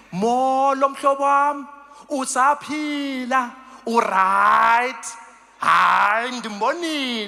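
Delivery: "Molo, mhlobo wam" slowly, vowels stretched wide; open-mouthed Xhosa. Shown as a demonstration. Showing warm air lets Xhosa take time, open-mouthed "Molo". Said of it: "we open our mouths".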